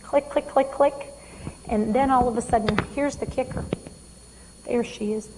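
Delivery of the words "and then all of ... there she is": usual speaking voice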